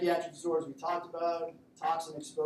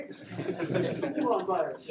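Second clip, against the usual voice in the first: slow, louder, laughing through the words; laughing mock diagnosis; 4 semitones higher, 6 dB louder, much slower than usual. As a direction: drag it out while cracking up. Retold doctor guess, laughing.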